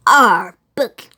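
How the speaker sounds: groaned. Acted. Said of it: "groaned the Captain".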